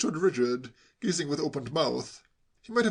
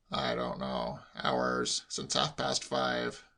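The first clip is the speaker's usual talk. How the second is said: slow, subdued grumble; grudging uncle; 3 semitones lower, 3 dB quieter, much slower than usual. A narrator's reading. Uncle answering nephew's questions, grudging. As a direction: give it up reluctantly and slowly.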